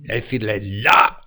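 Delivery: ordinary talk, nothing marked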